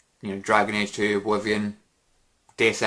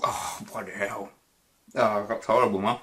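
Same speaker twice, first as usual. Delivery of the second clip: words mangled by a mouthful of coffee; retching disgust through a full mouth. Real, not acted. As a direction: speak through gritty coffee grounds.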